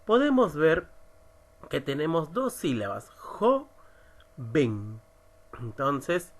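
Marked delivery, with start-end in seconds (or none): 3.2-5.0 jo-ven, split into two separate syllables; silabeado. Shown as a demonstration. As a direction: say 'jo', pause, then low 'ven'. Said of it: "si la silabeamos". (separando las sílabas: jo, ven)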